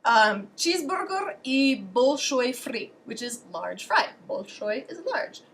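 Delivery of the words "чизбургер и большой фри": put-on slow order in a Russian accent; imitated careful Russian ordering; Russian pronunciation. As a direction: spell it out slowly, Russian-style. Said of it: "that's how you say it in Russian".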